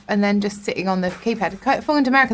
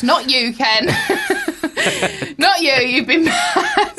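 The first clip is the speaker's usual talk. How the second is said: high, laughing mock scolding; giggling schoolyard taunt at Ken; laughing mockery; 6 semitones higher, much slower than usual. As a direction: tease him while cracking up. Mocking colleagues excluding Ken.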